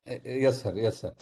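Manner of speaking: normal talking voice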